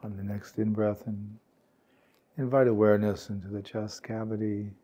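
calm, unhurried meditation guide, words spaced by long pauses; guided meditation. Real, not acted. Meditation teacher guiding warm-hearted peers to relax, calm and unhurried. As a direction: gently lead a sitting group into stillness, leaving space.

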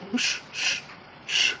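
sharp voiceless shushes; shooing hiss. Acted. Shooing off a crow, hissed shushes. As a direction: hiss to drive a bird away.

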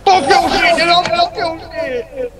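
high-pitched heated shout; high yell, second curse dragged out; yelled cursing. Real, not acted. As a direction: curse them out, high and loud. Cursing out a player mid-game.

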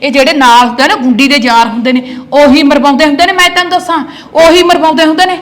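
wife shouts at husband over his drinking pals; angry shout. Acted. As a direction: yell at him about his friends.

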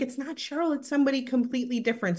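ordinary delivery

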